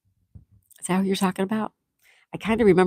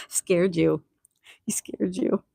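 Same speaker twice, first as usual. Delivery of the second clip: laughing; much slower than usual. Real, not acted. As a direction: squeeze it out while laughing. Laughing at a chat joke.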